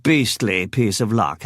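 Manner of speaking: usual speaking voice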